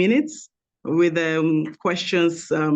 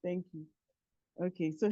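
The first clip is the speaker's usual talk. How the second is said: very faint, distant voice; weak, far-off speech; barely audible; 14 dB quieter than usual. Real, not acted. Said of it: "Can you be a little bit louder?".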